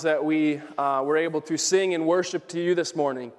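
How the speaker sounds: hushed opening prayer. Acted.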